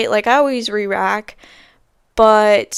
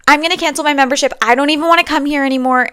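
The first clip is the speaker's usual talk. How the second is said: fast, louder, higher huffy threat; mock yell; 5 semitones higher, 5 dB louder, faster than usual. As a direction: rattle off the threat like an offended member. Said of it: "then she yells".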